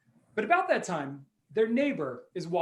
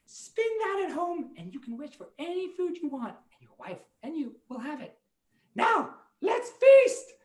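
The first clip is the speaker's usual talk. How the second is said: mouse voice; 9 semitones higher than usual. Acted. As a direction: squeak the gift, then cheer. Mice giving dreidel.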